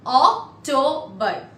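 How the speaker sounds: OCTOBER split as Oc, to, ber; syllable-by-syllable demo. Shown as a demonstration. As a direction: break "October" into three separate syllables. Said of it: "we'll pronounce it for you".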